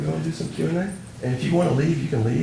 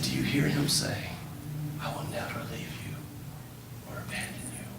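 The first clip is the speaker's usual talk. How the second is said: soft, fast breathy promise; very soft, tender hush; hushed tender whisper; 6 semitones lower, 11 dB quieter, faster than usual. Retold or imitated.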